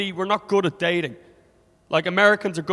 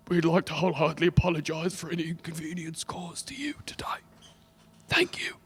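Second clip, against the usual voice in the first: hushed announcer, almost no voice; whispered apology; 2 semitones higher, 7 dB quieter than usual. Acted. Announcer apologising to passengers for inconvenience, whispered. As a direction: sink into a whispered public apology.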